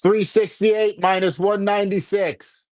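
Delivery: slow, high, deliberate voice command; command to Alexa. Acted. Talking to Alexa, slow careful command. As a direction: spell it out slowly for the smart speaker.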